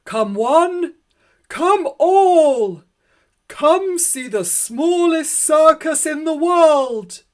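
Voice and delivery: very loud, high barker's cry; booming street announcement for the circus; ringmaster's call. Acted. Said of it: "cried Leo Massini".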